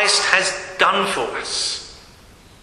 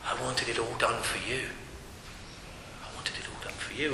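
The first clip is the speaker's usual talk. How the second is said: much softer, fading to a breathy hush; near whisper; 8 semitones lower, 17 dB quieter than usual. Acted. The Father to us, fading into a hush. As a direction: let the words fall away to a whisper. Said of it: "the Father looks at us and says".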